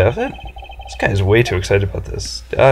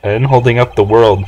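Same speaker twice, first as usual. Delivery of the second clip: ordinary talk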